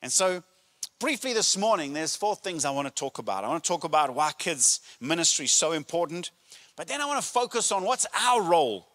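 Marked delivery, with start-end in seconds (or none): none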